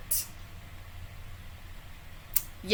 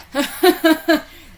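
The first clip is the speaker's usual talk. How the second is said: high, loud cackling laugh; loud burst of laughter; 8 semitones higher, 8 dB louder than usual. Real, not acted. Laughing at own story.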